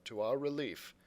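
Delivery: usual speaking voice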